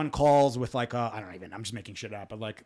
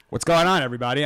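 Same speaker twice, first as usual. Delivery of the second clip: warm announcer; 8 dB louder, faster than usual. Acted. Opening the show, announcer-like.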